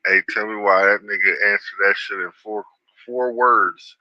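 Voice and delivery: ordinary talk, nothing marked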